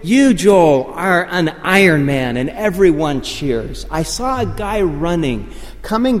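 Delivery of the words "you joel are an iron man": high, loud triumphant proclamation; imitated race announcer at full volume. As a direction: proclaim it to the finisher over a crowd. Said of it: "he says this from the P A".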